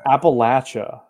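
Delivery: Appalachia with a punched short-a LATCH; southern pronunciation. Shown as a demonstration. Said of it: "That's, that's how".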